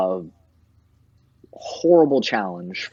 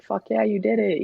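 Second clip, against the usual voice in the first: cheering; 12 semitones higher than usual. Retold or imitated. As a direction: hype them up like a coach. Hyping up a client after tattoo.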